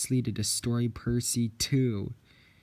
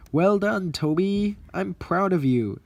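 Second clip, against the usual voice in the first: proud praise; 9 semitones higher, 9 dB louder than usual. Acted. Boss praising Toby loudly.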